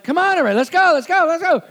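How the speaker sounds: loud, high mock urging; hammed-up shout to get everyone moving; impatient hurrying. Young husband rushing wife in early married days, impatient.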